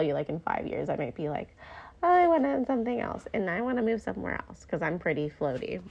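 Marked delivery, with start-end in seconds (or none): 2.0-4.6 higher-pitched, lightly acted longing; lightly mocking your own restlessness; playful restless whim. Voices her future restless self.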